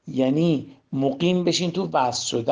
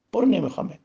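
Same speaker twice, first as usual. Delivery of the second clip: higher-pitched, brusque send-off; dismissive rejection; 5 semitones higher, faster than usual. Shown as a demonstration. Preacher shows worshippers casting off the ego, dismissive. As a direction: cast it away behind you. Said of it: "این الله اکبر اینجوری بگین".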